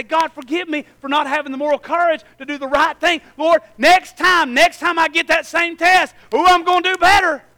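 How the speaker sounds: rising to a loud vow; prayer of repentance. Retold or imitated. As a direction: plead with the Lord, then resolve. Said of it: "I come back to repentance and I say".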